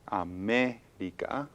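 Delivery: América slowed, ME stressed. Shown as a demonstration. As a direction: stretch it out and lean on ME. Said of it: "We hear the stress on the me".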